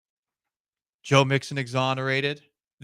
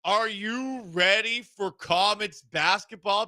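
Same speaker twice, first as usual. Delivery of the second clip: excited; 9 semitones higher, 7 dB louder, slower than usual. Real, not acted. Hyping WNBA return.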